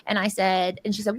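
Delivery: normal talking voice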